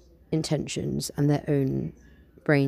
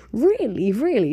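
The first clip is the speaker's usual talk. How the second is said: higher, louder, sceptical "really"; cynical chuckle; 7 semitones higher, 6 dB louder than usual. Acted. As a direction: say it with a doubtful chuckle. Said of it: "she chuckled with slight cynicism".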